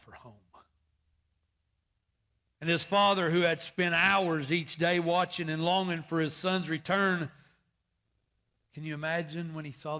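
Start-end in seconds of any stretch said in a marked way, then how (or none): 2.6-7.3 loud, rising preacher's cry; full-force preaching. Acted. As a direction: preach it out to the pews. Preaching build-up.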